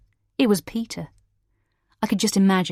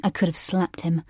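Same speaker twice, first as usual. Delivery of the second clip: usual speaking voice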